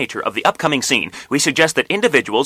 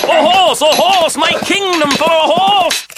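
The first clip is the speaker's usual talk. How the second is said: high, loud anguished cry; desperate shout; 6 dB louder, slower than usual. Acted. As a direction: scream it for your life. Richard's desperate final cry.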